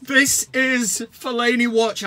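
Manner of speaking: slow, stretched-out grand announcement; segment title announcement. Acted. Host re-announcing the segment after the clip, slow and grand. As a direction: sell the title like a jingle.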